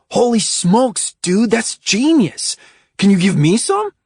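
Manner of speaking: excited. A narrator's reading. Oscar amazed and eager.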